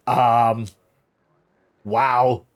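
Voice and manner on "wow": normal talking voice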